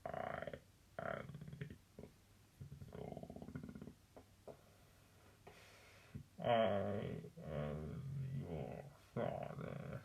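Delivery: hushed, very low, slow villain line; low, drawn-out dark-lord delivery; Darth Vader impression. Shown as a demonstration. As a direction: go deep and quiet, long gaps between words. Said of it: "when it needs to be more like".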